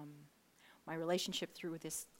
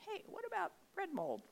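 high, light voice floating an idea; God casually suggesting; 13 semitones higher than usual. Retold or imitated. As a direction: toss out the suggestion in a high, playful pitch.

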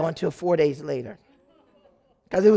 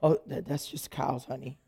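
lower, softer, easy reassurance; calm husband; 6 semitones lower, 7 dB quieter than usual. Retold or imitated. Husband calmly reassuring his wife about cows, lower. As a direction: shrug it off gently, lower. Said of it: "He goes".

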